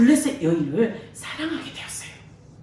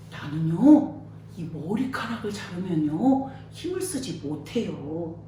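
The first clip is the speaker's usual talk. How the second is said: secret confession; 8 semitones lower, 8 dB quieter than usual. Acted. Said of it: "그 비밀을 말해버리고".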